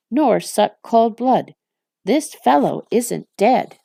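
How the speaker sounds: angry rebuke. Acted. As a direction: blast him for his ignorance, slowing on the last words. Shagrat angrily rebukes Gorbag.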